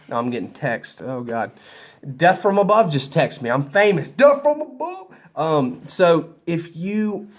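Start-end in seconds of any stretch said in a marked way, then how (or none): 4.1-5.1 high-pitched, rising shout-out; mock hype. Man joking that he's famous after a text, mock hype. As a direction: sing out the name high and rising, playfully. Mock fame boast, echoing the name.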